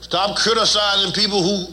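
ordinary talk

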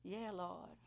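soft, subdued reply, a touch humbled; retold tearful answer. Woman answering God as tears stream, softly retold. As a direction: answer quietly, as if chastened. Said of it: "tears are streaming down".

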